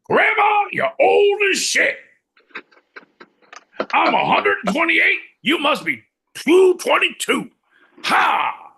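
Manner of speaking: loud high gung-ho shout; Al Pacino. Retold or imitated. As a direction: yell it like a crazed colonel. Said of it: "Al Pacino will probably do it".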